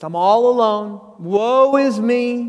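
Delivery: lightly hammed-up self-pity, drawn out; a hint of a sulky prophet moaning; pity party whine. Elijah moaning to God in a mild mock pity-party whine. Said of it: "what my mom would call the pity party".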